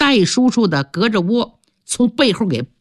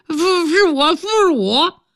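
toothless mumble; 8 semitones higher than usual. Acted. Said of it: "说话嘴都不兜风了".

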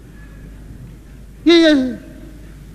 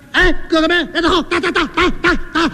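high, repeated hit-him calls; egging on the fight; 6 semitones higher, faster than usual. Acted. Thug urging his sworn brothers to beat Wu Song, shouting high. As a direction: whip the gang into attacking.

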